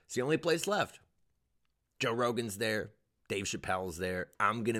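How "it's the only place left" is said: higher, firmer insistence; emphatic, higher-pitched claim; insistent repeat. Real, not acted.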